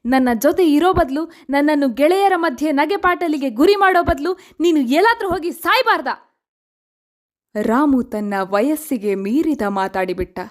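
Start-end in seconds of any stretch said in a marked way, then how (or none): 0.1-6.4 high-pitched, bitter reproach; angry boy blaming someone, pitched well up; angry outburst. Acted. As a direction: lash out in a raised pitch, wounded and resentful. Boy's bitter outburst at his mother.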